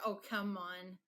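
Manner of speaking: ordinary talk, nothing marked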